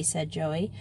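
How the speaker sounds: ordinary delivery, nothing marked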